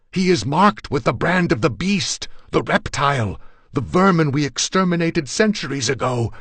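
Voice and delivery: loud high raving, building to a frenzy; frenzied, high-pitched full-voiced tirade; enraged screaming. A narrator's reading. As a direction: scream it while struggling to get free. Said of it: "I screamed".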